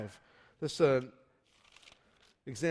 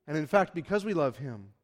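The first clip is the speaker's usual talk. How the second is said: normal talking voice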